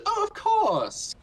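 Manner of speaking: very high, slow, gushing; sing-song eager shopkeeper; cheery NPC. Acted. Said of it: "this person says".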